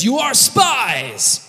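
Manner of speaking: high, slow, drawn-out accusation; hammed-up accusing official; mock accusation. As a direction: stretch it out like a theatrical governor. Joseph accuses brothers.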